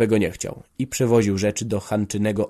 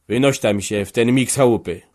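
louder, furious order to get out; angry shout; 7 dB louder than usual. A narrator's reading. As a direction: throw him out with a loud angry voice. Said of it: "krzyknął". (gniewny krzyk ojca)

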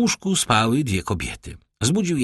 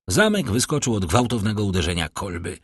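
plain reading voice

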